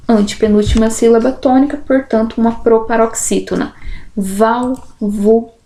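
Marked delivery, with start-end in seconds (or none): 4.1-5.5 "Válvula" slowly split into syllables. Shown as a demonstration. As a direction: say "Válvula" one syllable at a time. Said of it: "Separação de sílabas".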